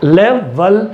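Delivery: लेवल broken slowly into LEV, VAL; syllable by syllable. Shown as a demonstration. Teacher showing first and second sound, लेवल split as LEV, VAL. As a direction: say लेवल as two separate beats, LEV then VAL. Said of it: "तो लेव पर".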